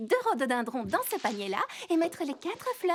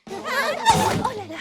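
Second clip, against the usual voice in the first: 1 slow laughing oh là là; 10 semitones higher, 6 dB louder, much slower than usual. Acted. Reacts to sneezing guest.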